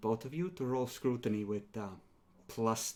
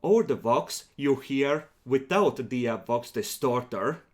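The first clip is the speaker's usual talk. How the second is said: ordinary talk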